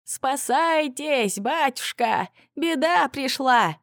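high, slow fake panic cry; frantic wail. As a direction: wail it out like pretend terror. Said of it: "истошно завопил". (истошный вопль, высоко и протяжно)

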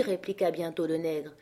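ordinary delivery

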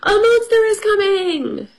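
hammed-up fright; animals' alarm. A narrator's reading. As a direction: wail the warning high like panicked storybook animals. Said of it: "said Fox and Rabbit and Mouse".

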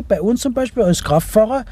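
ordinary talk

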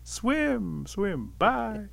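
high call, easing off from a yell; singsong send-off. A narrator's reading. Rabbit sees tricked Otter drift away, calling high. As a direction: call it lightly after someone leaving.

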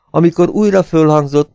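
ordinary delivery

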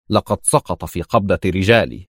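ordinary delivery, nothing marked

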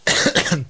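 short burst of laughter; real laugh. Real, not acted. Reader breaks off laughing.

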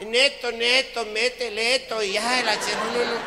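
put-on high, loud, drunken girl voice; high loud drunken slurring; drunk party girl. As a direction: slur it like a tipsy party girl. Drunk girls voiced in joke.

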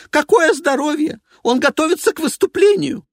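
high, loud and indignant; an outraged woman snapping; shrill diva voice. Acted. Evelina voiced.

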